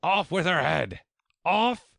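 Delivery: high, loud screamed command; furious queen's high scream. A narrator's reading.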